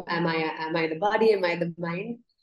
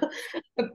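short, high burst of giggling; embarrassed laugh; 8 semitones higher, 7 dB quieter than usual. Real, not acted. Guest laughs at her own bathroom story, giggling.

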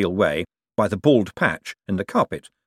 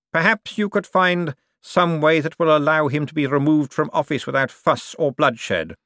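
raised, deliberate, persuasive voice; insinuating Cosmo; 7 semitones higher than usual. Acted. Cosmo asks Bent to remove the chairman, smoothly insinuating. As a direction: nudge the listener, drawing out the end.

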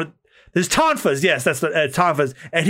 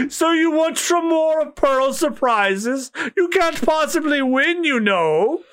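taunting pirate; 11 semitones higher, slower than usual. Acted. Reading a pirate's boast aloud.

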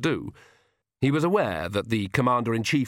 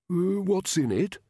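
slow, higher, hesitant croak; feeble, drawn-out query from a weakened king; weak croak; 9 semitones higher, slower than usual. A narrator's reading. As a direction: croak out a wary question. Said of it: "Verence croaked".

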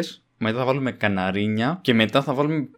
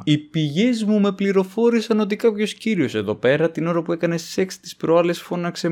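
lightly voiced secretive insider, higher; mysterious gossip; 6 semitones higher than usual. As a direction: drop hints like a mock detective. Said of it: "Αγκάθα Κρίστη το κάνω το πράγμα". (ελαφρώς μυστηριώδης φωνή κουτσομπόλη)

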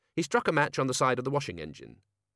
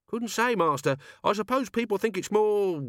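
much higher-pitched evasive servant; 10 semitones higher, 3 dB louder than usual. A narrator's reading. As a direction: dodge the master's question in a high voice. Albert evades master's question.

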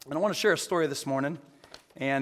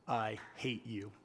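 through clenched teeth; 5 semitones lower, 11 dB quieter, slower than usual. Acted. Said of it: "said through clenched teeth".

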